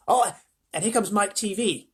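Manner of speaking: put-on high, excited old-man voice; Grandpa Joe. A narrator's reading. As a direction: point him out in a high voice. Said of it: "said Grandpa Joe".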